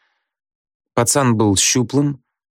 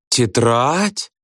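higher, drawn-up indignant question; indignant chorus; 4 semitones higher than usual. A narrator's reading. Front-row girls protest about the notebook, indignant. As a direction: rise into offended disbelief. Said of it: "дружно возмутились девицы".

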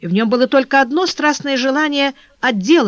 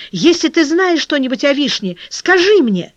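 urgent plea, higher and louder at the end; crying out; 6 semitones higher, 3 dB louder than usual. A narrator's reading. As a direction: cry out, pleading to be told. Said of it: "вскричал работник". (взволнованный вскрик)

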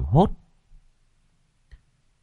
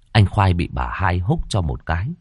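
usual speaking voice

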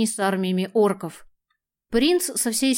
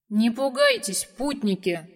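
booming voice. A narrator's reading. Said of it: "Громкий голос озарил своим звучанием всю пещеру".

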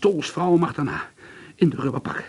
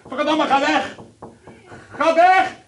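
screaming much higher and louder; shrieking panic at the figure; terrified scream; 6 dB louder, slower than usual. Acted. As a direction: yell to drive away a menacing intruder. Screams at intruder.

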